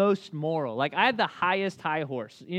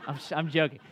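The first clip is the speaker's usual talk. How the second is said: ordinary delivery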